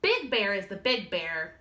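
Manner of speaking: ordinary delivery, nothing marked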